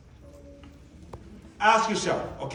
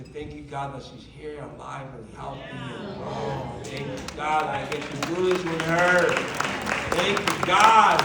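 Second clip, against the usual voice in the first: grateful prayer; 7 semitones lower than usual. Retold or imitated. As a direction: whisper thanks to God. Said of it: "I say".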